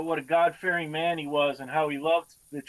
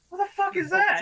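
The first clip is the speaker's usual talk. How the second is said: much higher, stunned exclamation; shocked outburst; 13 semitones higher than usual. Retold or imitated. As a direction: blurt it out, caught completely off guard. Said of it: "everybody's going".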